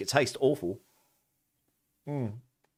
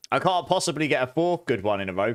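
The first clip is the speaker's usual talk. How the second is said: normal talking voice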